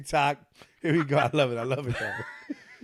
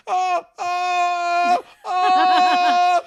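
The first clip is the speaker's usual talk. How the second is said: mock wailing; 10 dB louder, much slower than usual. Imitating crash victim wailing.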